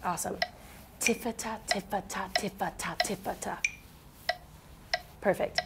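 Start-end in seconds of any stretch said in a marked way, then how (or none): 1.0-3.6 quiet, breathy tiffata pattern; soft beatbox syllables. Shown as a demonstration. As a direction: murmur the tiffata rhythm softly. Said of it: "The first one goes like this".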